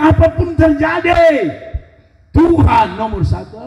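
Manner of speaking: slow, declaiming shout; mock proclamation. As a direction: proclaim it like a pious boast. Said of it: "Kita bilang".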